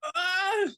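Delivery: high-pitched comic shriek; hammed-up crying scream; mock wailing scream. Wife losing it over cereal, mock scream.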